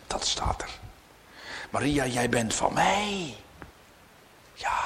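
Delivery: whispered, soft and intimate; whispered sermon aside; hushed whisper. Acted. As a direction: lean in and whisper it to them. Preacher drops to whisper.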